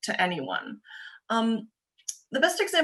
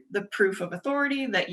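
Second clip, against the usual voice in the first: ordinary talk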